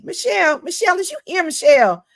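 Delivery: high, loud stage welcome; big excited introduction; calling out. Real, not acted. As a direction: call the guest up like a hype host. Host calls guest onto the stage.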